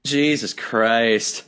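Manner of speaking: exasperated groan. Real, not acted. Exasperated at Sasha topic.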